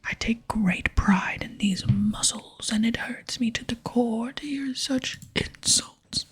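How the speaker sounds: forceful stage whisper with voiced swells; vain, offended barbarian. Barbarian defends his physique to a visitor, offended stage whisper. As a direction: play a strongman's wounded pride.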